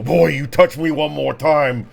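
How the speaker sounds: gruff female threat. Acted. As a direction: threaten him, gruff and hard. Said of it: "you hear a gruff, uh, female voice go".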